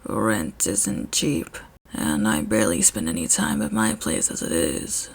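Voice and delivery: very low, soft grumble; Shota's deep quiet voice. A narrator's reading. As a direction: mumble it, a little ashamed.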